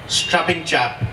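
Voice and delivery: usual speaking voice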